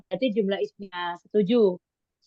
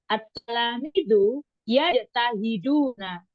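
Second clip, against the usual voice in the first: slow reading; slower than usual. Shown as a demonstration. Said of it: "pelan-pelan".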